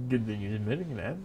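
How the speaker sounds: plain reading voice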